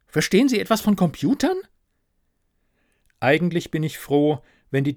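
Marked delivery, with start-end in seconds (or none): 0.1-1.6 high, quick, pleading question; pleading ghost manager. Acted. Ghost asks narrator for computer help, high and urgent.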